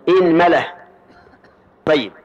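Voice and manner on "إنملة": إنملة said inmala, hamza with kasra, mim with fatha; short i at the start of إنملة. Shown as a demonstration. Teacher gives students the third reading, إنملة with opening i. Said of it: "كسر الهمزة وفتح الميم".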